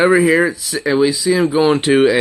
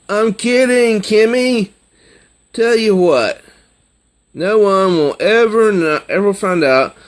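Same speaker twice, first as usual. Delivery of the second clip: much higher, louder upbeat joking; cheery teasing; 8 semitones higher, 4 dB louder than usual. Retold or imitated. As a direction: bounce in with a playful grin. Boy cheerfully teasing on date.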